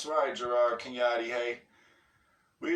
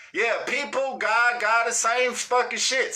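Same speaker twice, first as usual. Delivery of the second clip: exasperated; 6 semitones higher than usual. Real, not acted. Mocking a comment.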